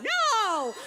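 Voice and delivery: high, loud, panicked cry of no. Retold or imitated. Watching opportunities rush downstream, panicked shout. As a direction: wail no as if losing everything.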